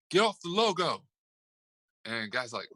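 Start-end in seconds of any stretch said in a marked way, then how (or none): 0.1-1.0 mild, high-pitched imitated yell; mock yell. Retelling a coach warning a player off the logo, mild mock yell. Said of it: "a shouting match and an argument pregame".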